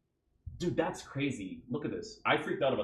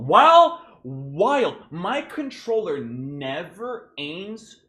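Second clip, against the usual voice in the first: much higher, louder, very slow frustrated cry; exasperated outburst; 6 semitones higher, 11 dB louder, much slower than usual. Real, not acted. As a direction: stretch it out in disbelief at the game. Raging at game controls.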